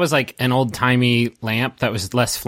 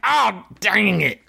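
higher, slow, put-on yell of a wounded ruffian; yelled complaint; 8 semitones higher, slower than usual. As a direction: drag out the curse like a shot thug. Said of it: "you hear 'em yell".

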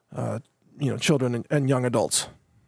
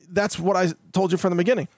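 usual speaking voice

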